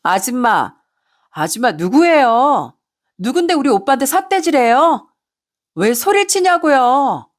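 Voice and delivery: hammed-up tipsy outrage, dragging the question endings; angry drunk mistress. Drunk mistress confronts the wife over her man, indignant. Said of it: "대뜸 화를 내기 시작하더라고요".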